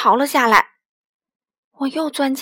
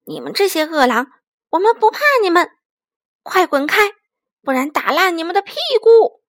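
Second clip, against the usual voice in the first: loud, high-pitched angry threat; 怒气冲冲; 4 semitones higher, 6 dB louder than usual. A narrator's reading. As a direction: chase the wolves off, voice rising and louder. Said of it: "她怒气冲冲地站在门边". (怒气冲冲地大声喝斥)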